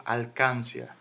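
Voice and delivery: "alcancia" stressed on CAN, al-CAN-cia; mis-stressed "alcancia" as alCÁNcia; wrong stress on CAN. Shown as a demonstration. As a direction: punch the middle CAN of "alcancia", run "cia" together. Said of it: "Yo no digo".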